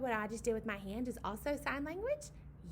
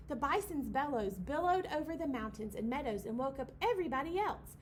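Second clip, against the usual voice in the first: usual speaking voice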